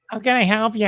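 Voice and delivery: high put-on clerk voice; odd-voiced store clerk. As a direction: imitate a comic scene's strange talker. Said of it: "You ever see that Loudermilk scene".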